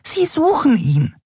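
high, tiny fairy voice, upset; a little winged friend scolding in alarm; agitated fairy. A narrator's reading. (aufgeregte kleine Fee, hoch)

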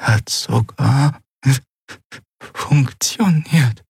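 slow, faint, breathy; weak whisper. Acted. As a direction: barely get the words out. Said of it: "Sie konnte ihn kaum hören".

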